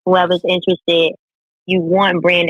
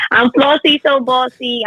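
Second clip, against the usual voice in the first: higher, punchy self-introduction; announcer intro; 7 semitones higher than usual. Acted. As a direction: announce your name like a show host. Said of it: "I gotta do the intro".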